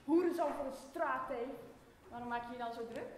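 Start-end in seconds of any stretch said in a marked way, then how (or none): 0.1-1.5 spat-out insult. Acted. Trading insults.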